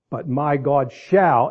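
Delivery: slow, leaning hard on "shall"; stress on shall. Shown as a demonstration. Preacher reading scripture to the congregation, emphasis on "shall". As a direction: read it slowly and land on "shall". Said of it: "emphasis the word shall".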